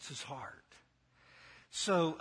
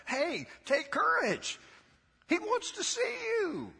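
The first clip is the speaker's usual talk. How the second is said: eager disciples. Retold or imitated. Disciples calling to the beggar.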